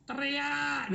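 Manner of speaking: rallying a seminar crowd to yell; shouted answer. Shown as a demonstration. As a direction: shout Teriak to show the crowd how. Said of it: "ikut teriak".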